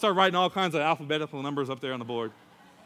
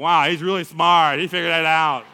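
imitated awestruck crowd; impressed classmates; 10 dB louder than usual. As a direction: marvel aloud at the genius. Classmates marvel at him.

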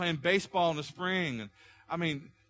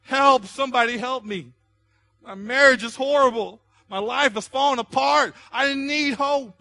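much higher, loud, desperate cry, slow at the end; inner scream for help; 10 semitones higher, 13 dB louder, slower than usual. Acted. Pastor voicing hurting strangers crying inside, loud desperate scream. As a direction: cry out like you're going under. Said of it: "they're screaming out".

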